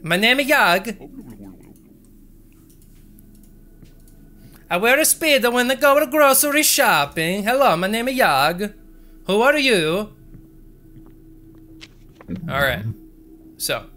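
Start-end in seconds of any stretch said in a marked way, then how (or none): 0.0-10.1 much higher, louder silly Simlish voice; high, loud goofy game-character voice; Sims character voice. Acted. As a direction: chirp like a cheerful Sims character. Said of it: "sounded like a whole Sims character".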